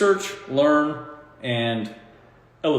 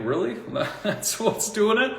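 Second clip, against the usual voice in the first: mock disbelief; 8 semitones higher than usual. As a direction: pretend you can't believe what you just heard. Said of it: "you sit there and think".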